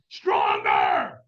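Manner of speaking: harsh, roaring scream; screamed command. Retold or imitated. Trainer screaming at a half-hearted firewalker, full roar. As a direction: scream it right in someone's face. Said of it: "screamed at me".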